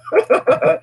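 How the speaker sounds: high-pitched cackle of amusement; laughing. Real, not acted. Joking that no voters have horns, laughing.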